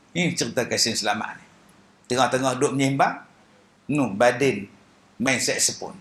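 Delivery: ordinary talk, nothing marked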